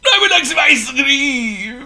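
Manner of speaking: high, slow, wheezy demand; wheezing greedy. A narrator's reading. Overfed Potto orders ice cream, high slow wheeze. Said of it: "wheezed Potto".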